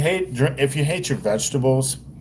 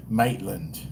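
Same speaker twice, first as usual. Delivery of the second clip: softly echoed attempt at an Australian accent; softer, imitation Australian twang; mimicked Australian accent; 3 semitones lower, 7 dB quieter than usual. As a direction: parrot it quietly in rough Australian. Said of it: "how much I try to mimic her accent".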